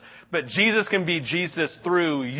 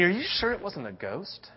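nervous doubt; 6 dB quieter than usual. Acted. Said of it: "They're saying".

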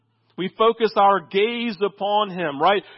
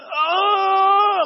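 high crying shout; a baby wailing as she reaches out; high, loud sobbing cry; crying toddler "oh"; 12 semitones higher, 5 dB louder than usual. Retold or imitated.

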